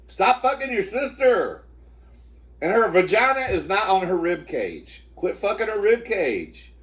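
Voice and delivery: high, exasperated holler; scolding the dog. Real, not acted. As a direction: yell at a misbehaving dog to stop. Yelling at the misbehaving dog.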